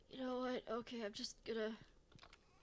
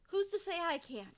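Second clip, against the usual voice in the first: 1 defiant declaration; 6 semitones higher, 4 dB louder than usual. Real, not acted. Mock-defiant rhetorical question.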